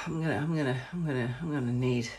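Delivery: pleading under the breath for a toilet; desperate murmur. Retold or imitated. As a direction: mutter it like you're about to burst. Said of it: "I'm like".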